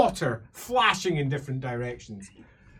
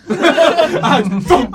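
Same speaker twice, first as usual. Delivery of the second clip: normal talking voice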